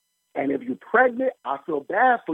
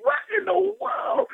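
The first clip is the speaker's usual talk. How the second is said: high, slow, crying-out disbelief; mock wail of shock; a high drawn-out what in the world; slower than usual. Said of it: "I was like".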